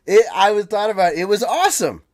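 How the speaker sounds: real fan enthusiasm; excited raving. Real, not acted. Still raving about the performance.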